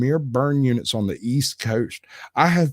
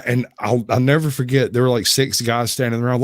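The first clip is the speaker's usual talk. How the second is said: ordinary talk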